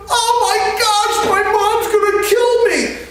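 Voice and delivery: very high, sing-song squeal of panic; panicked kid voice. Retold or imitated. Injured friend fearing his mom, high panicked squeal. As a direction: squeal it like a scared boy dreading his mom. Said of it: "And he's going".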